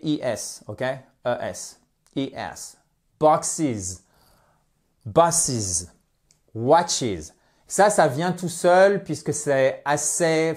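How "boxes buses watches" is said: BOXES, BUSES, WATCHES each ending in a distinct iz; clear -iz plurals. Shown as a demonstration. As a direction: model each plural separately, falling on the iz. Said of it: "on fait bien le is".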